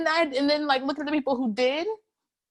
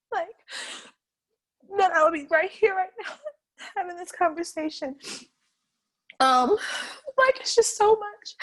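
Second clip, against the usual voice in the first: tearful; 4 semitones higher than usual. Real, not acted. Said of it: "I'm, like, so emotional about it".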